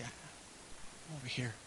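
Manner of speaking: soft, slow, small voice from hiding; timid hiding reply. Pretend. Adam answering God from hiding, softly. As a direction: answer meekly from a hiding place.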